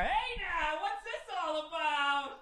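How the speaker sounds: high, slow, drawn-out sportscaster call; hammed-up announcer voice from across the bar; Howard Cosell announcer. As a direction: call it like a Monday night broadcaster. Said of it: "dressed like Howard Cosell".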